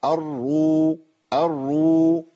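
"الرو" with a long steady oo; held waw madd. Shown as a demonstration. Teacher showing waw madd, "الرو" held long. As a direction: stretch the oo of "الرو" on a level note. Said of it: "بعد ضم".

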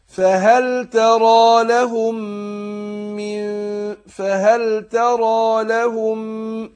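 slow chanted tajweed recitation, heavy nasal hum; Quran recitation. Shown as a demonstration. Teacher demonstrating recitation to students, slow chant. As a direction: recite slowly, drawing out the ghunna.

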